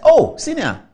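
high, loud, retold exclamation; mock startled deference; startled recognition. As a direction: exclaim as you realise who is calling. Realises the caller is his senior.

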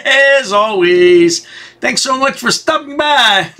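laughing through the goodbye, slowing down; laughing sign-off. Real, not acted. Vlogger laughing as he thanks viewers for stopping by.

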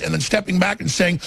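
ordinary talk

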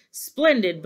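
lightly booming Santa; a touch of hearty volume. A narrator's reading. Santa announces the sleigh is loaded, mildly booming. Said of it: "boomed Santa".

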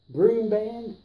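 ordinary delivery

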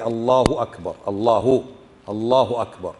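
low, careful "Allahu akbar", damma kept separate from waw; clear short damma. Shown as a demonstration. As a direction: say "الله أكبر" with a distinct short u, no w. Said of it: "نميز بين الواو وبين الضمة".

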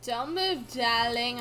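usual speaking voice

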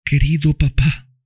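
put-on high child voice, tender; dying girl speaks lovingly to Tom and Mami, high child voice; loving little girl. A narrator's reading. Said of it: "dijo Eva".

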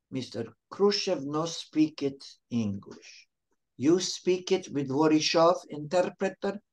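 imitated Russian operator with broken English; Russian accent. Moscow operator offering the caller an interpreter, mock Russian accent. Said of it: "the operator from Moscow calls and says".

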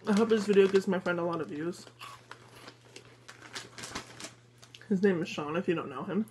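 chewing while talking; eating chips between words; mouth full. Real, not acted. Seen 4: Hand to mouth at -2, holds chip bag, mouth working throughout.

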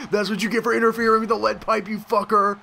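high, excited gloating; gloating yell. Real, not acted. Player gloating at an interfering opponent, excited. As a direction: crow it at the beaten rival.

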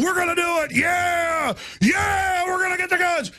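mock rally shout. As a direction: yell it like a crowd whipped up at a rally. Mocking gun-control rally cheering.

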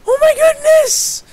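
hammed-up falsetto shock; Bad Boy Halo impression. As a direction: squeal it in a cartoonish shocked falsetto. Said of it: "How does Bad Boy Halo talk?".

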